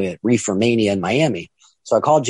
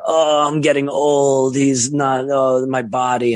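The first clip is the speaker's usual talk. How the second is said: whiny complaining; 3 semitones higher, much slower than usual. Retold or imitated. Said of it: "He's like".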